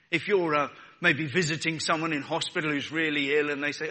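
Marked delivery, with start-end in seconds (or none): none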